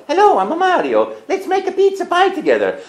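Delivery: high, bright Italian Mario voice. Acted. Audition take, greeting players as Mario. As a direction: pitch way up into a cheerful cartoon plumber. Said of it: "I hear action, I go".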